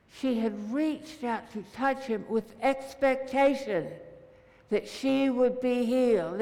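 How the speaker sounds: ordinary talk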